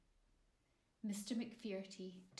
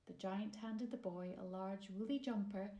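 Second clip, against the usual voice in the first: usual speaking voice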